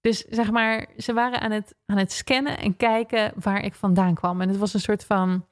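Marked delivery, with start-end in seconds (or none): none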